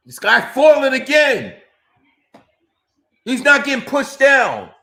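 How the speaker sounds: high, loud outraged protest; indignant shout. Real, not acted. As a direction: shout it like you can't believe it. Yelling in frustration at fighter.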